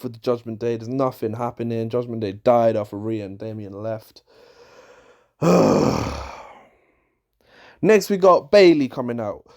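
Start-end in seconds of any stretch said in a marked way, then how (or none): 5.4-6.3 long exasperated exhale; weary sigh. Real, not acted. Reviewer done with a stale storyline, weary sigh. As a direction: let out a heavy, fed-up sigh.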